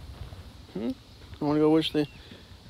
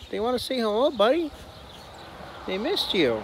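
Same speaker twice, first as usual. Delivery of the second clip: much higher, sing-song coaxing of the dog; high dog talk; 9 semitones higher than usual. Real, not acted. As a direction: coax your buddy up high and bright.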